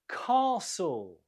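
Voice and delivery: "Castle" with a long ah, stressed on the first syllable; long vowel, first-syllable stress. Shown as a demonstration. Teacher models the word, long stressed ah in "Castle". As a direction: draw out the ah in "Castle", silent t. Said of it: "we have a long vowel sound".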